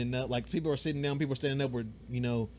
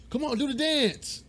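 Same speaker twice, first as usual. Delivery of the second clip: much higher, slow coaxing plea; pushy fan; 12 semitones higher, slower than usual. Retold or imitated. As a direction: beg the star to perform like a pushy fan. Fan begging celebrity to perform.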